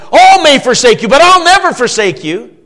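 loud, high, defiant declaration; angry, proud outcry. Retold or imitated. Peter protesting to Jesus, angry proud outcry. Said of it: "he cries out in anger and, and pride".

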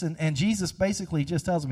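ordinary talk, nothing marked

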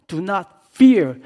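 loud, slow, firm command; bold command. Acted. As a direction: call it out like a brave spy. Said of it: "they said".

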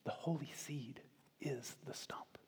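hushed, unvoiced aside to the listeners; whispered confidentially, very softly. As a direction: lean in and whisper it like a secret. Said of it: "and whispers to us".

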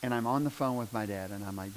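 ordinary talk, nothing marked

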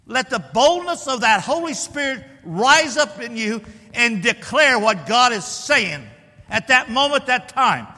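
fervent pulpit proclamation; full-force preaching. Real, not acted. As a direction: preach it boldly, hammering the key words.

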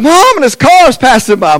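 high, loud pleading yelp; boy protesting. Retold or imitated. Spanked boy pleading with Mama about passing cars. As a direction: whine it like a spanked kid. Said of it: "I'm like".

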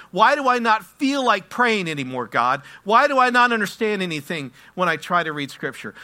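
desperate prayer building to a loud, high cry; anguished shouted prayer, steadily climbing; crying out to God. Acted. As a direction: plead with escalating force, question after question. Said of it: "simply cry out to God and go".

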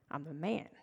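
low, soft repeat with a lift; quiet aside. A narrator's reading. Healed man repeating his claim to neighbors, quiet aside. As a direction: drop it low and hushed, rising at the end. Repeats the insisted line, hushed.

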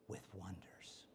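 breathy hushed whisper; whispered awe at the coming wonders. Real, not acted. Closing thought dropped to a hush.

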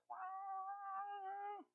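high, soft, awed wow; a soft swooning wow; breathy smitten wow. Retold or imitated. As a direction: sigh it out like a smitten girl. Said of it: "she's like".

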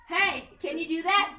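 high, loud, sing-song ask; hammed-up parent asking; mock parent request. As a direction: call out to the kid. Parent asking kid, acted.

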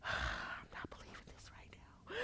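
sigh, then a frightened whisper; scared whisper. Retold or imitated. Scared patient in the machine whispering to be taken out. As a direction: whisper it, begging to be let out. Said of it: "I was scared".

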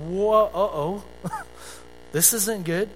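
a half-laughing uh-oh, slowing down; mock worried reaction. Preacher voicing a congregant's nervous uh-oh, half laughing. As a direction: play the nervous listener realising trouble.

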